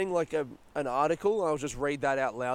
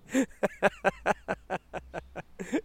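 high rising laugh; 5 semitones higher than usual. Real, not acted. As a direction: burst out laughing. Laugh at the prank.

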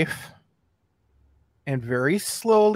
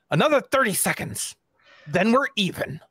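mock-stern; 5 semitones higher than usual. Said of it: "I look down at a non-existent watch".

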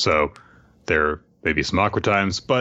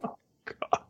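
usual speaking voice

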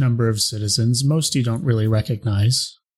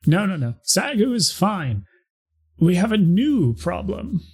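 townsperson voice; 8 semitones higher than usual. Acted. NPC complaining to the player.

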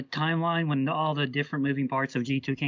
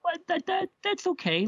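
much higher, talking himself into it; reassuring himself. Retold or imitated. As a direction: brush off the disappointment. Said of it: "and I'm like".

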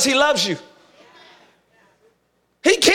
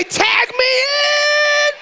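much higher, slow, stretched-out shout; call-out shout; 10 semitones higher, much slower than usual. Acted. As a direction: call it out for the room to repeat. Leads congregation in call-out.

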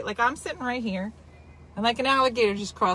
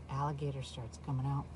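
dropped to a near hush, much softer; hushed suspense; 9 semitones lower, 14 dB quieter than usual. Real, not acted. Host hushing the big moment for listeners, much softer. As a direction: lean in and hush the reveal. Hushed build-up to alligator reveal.

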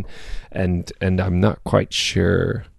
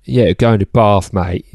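"Bath" said "Baf" in a light South London accent, "mate" tacked on; a lightly voiced South London lad saying "Bath" and "mate"; slower than usual. Shown as a demonstration. Joking to a friend in a light South London accent. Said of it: "that's very South London".

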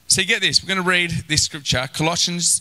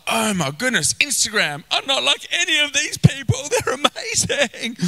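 insecure inner voice; 9 semitones higher than usual. Retold or imitated. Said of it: "it's like".

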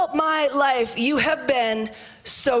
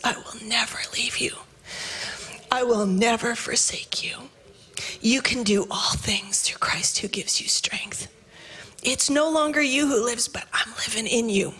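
still small voice whisper. Retold or imitated. God's still small voice comforting the weary, whispered. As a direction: breathe it out as a gentle whisper. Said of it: "the still small voice speaks up and goes".

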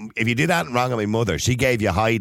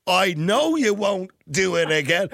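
slow raised mock-mum comeback; imitating mum, slowed and pitched up; mother's retort; 6 semitones higher, slower than usual. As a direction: lean on it like a mother mid-smacking. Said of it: "she'd be doing this".

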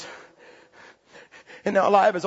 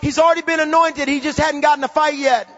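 high, fervent preacher voice building to a shout; fired-up preaching; 5 semitones higher, 4 dB louder than usual. Real, not acted. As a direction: drive it home to the congregation. Preaching at full force.

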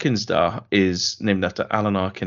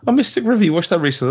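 mock surprise; 8 semitones higher, 5 dB louder, much faster than usual. Real, not acted. Teasing about a grim film.

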